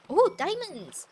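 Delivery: loud, high thrilled cry; excited shout. Real, not acted. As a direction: shout at the sight of treasure. Spotting diamonds.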